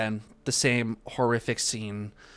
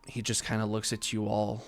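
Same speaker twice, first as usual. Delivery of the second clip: ordinary delivery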